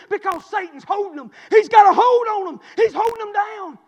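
fired-up pulpit shouting; full-force preaching. Real, not acted. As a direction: drive it home to the congregation, loudly.

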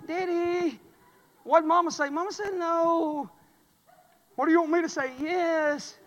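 put-on high, sweet coaxing girl voice; mock a daughter working her dad; imitating daughter. Daughter and dad dialogue acted.

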